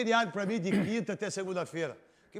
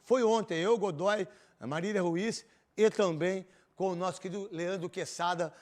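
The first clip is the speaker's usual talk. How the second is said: ordinary delivery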